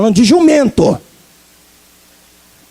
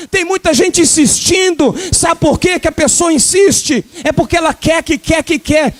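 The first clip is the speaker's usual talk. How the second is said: fiery preaching; 4 semitones higher than usual. Acted. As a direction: urge the congregation, full force. Preaching at full force.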